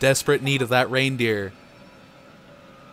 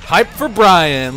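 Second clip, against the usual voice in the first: hype call; 5 semitones higher, 8 dB louder, much slower than usual. Real, not acted. Hyping a viewer in chat.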